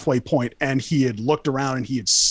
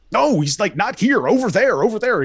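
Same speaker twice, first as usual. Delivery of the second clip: high, louder urgent redirect, no, not here, over there; urgent pointing out; 7 semitones higher, 4 dB louder than usual. Retold or imitated. Store clerk steering the cops to the right spot, urgent and loud. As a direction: wave the cops off, urgently insisting. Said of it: "He's just like".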